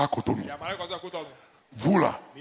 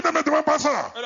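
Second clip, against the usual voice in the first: much higher defiant retort; defiant woman; 10 semitones higher than usual. Retold or imitated. Preacher voicing the woman refusing to answer, high and defiant. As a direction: snap back like the accused woman. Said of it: "Tu dis".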